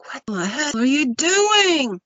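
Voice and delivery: high, loud startled yell; a wife's frightened shout; alarmed shout. Retold or imitated. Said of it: "And she's like".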